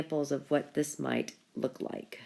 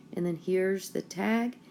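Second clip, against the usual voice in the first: ordinary talk, nothing marked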